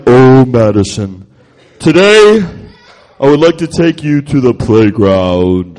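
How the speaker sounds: low, slow, pompous intoning; hammed-up grandiose delivery, stretched words; mock fancy speech. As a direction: invite a friend as if solemnly praying. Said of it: "someone talk to you like that".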